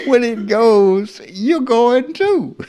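high, slow words breaking into laughter; high, slow amused laugh through the words. Real, not acted. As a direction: laugh it out as you say it. Laughing at the idea.

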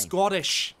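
mock Scottish accent. Streamer echoing a game shopkeeper, in mock Scottish. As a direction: put on a bad Scottish accent. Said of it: "I can't do a Scottish accent".

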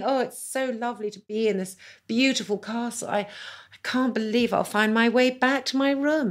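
gushing awkward guest. Retold or imitated. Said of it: "I was, like, saying".

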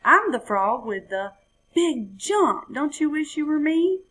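gloat, leaning on "I'm"; boastful frog. A narrator's reading. The frog bragging to the jaguar below. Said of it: "he cried".

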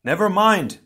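high, loud urgent yelp; hurried battle yelp. A narrator's reading. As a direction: cut him off with a yell. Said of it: "Pohatu yelped".

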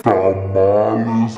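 a deep slow mock-dramatic "Tamales"; deep drawn-out tamales. Shown as a demonstration. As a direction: drag "Tamales" out low and slow.

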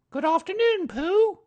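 sing-song motherly greeting; Kanga voice. A narrator's reading. Kanga greets Pooh, very high and motherly.

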